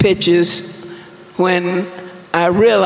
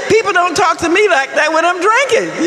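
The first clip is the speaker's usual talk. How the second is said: higher, indignant retort; mock indignation; 11 semitones higher than usual. Telling the room how mad the sponsor made her, mock indignant. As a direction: play the outraged drunk, pitched up. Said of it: "this woman made me so mad".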